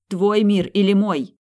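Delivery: loud, high, slow shout; urgent demand. A narrator's reading. Ethan hurries her to choose, loud urgent demand. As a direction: demand a choice, each word driven out loudly. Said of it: "поторопил он с выбором".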